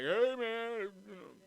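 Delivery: high, strange put-on drifter voice; homeless guy voice. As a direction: play a wild stranger greeting you. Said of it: "crazy homeless person".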